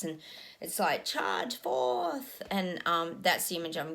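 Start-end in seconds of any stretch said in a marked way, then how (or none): 1.0-2.4 battle cry. Pretend. Battle charge in a vision.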